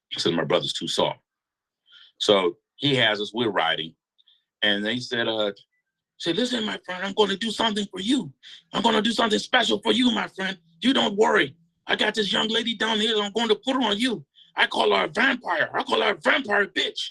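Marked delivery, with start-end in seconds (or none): 6.4-17.0 much higher, put-on voice of a belligerent friend; Mario promising a surprise to his friend, in a high put-on voice; Mario's voice. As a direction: play Mario promising a special favour.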